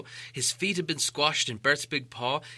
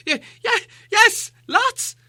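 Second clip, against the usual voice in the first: high, stammering frightened hobbit; terrified stammer; 9 dB louder, slower than usual. Acted. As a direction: stutter out in panic, shaking all over. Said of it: "said Bilbo".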